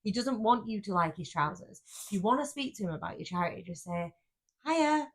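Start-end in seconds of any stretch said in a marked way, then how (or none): 4.6-5.1 friendly greeting. Shown as a demonstration. Demonstrating friendly approach.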